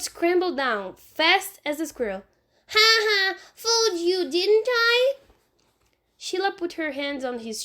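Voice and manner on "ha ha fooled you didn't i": put-on high, loud taunting little boy; gloating child. Fudge taunting Sheila after scrambling down, gloating. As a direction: crow over the trick.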